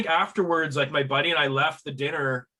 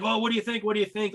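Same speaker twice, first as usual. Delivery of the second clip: higher, eager asking for a verdict; mock-excited friends waiting on a reaction; 7 semitones higher, faster than usual. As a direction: pester him for his opinion. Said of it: "they're all excited".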